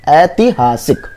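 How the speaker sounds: slow "ऐतिहासिक", ai-ti-haa-sik, each syllable clear; slow spelling demo. Shown as a demonstration. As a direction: drag out "ऐतिहासिक" so students hear the short ti. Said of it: "त में छोटी की मात्रा".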